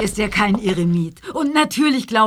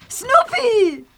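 high call for the dog; 12 semitones higher than usual. Acted. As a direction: call his name out the window. Calling the dog outside.

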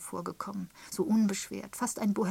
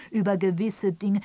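loud, higher reproach; reproachful; 4 semitones higher, 11 dB louder than usual. A narrator's reading. Canon cutting in on Joan's gossip, reproachful. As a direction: chide her firmly, pressing each word. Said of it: "sagte der Kanonikus vorwurfsvoll".

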